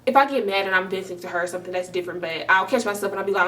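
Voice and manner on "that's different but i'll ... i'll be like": ordinary talk, nothing marked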